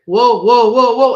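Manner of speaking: loud alarmed whoas, rising; retold yell. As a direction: shout it as the rack tips over. Stranger yelling as rack falls.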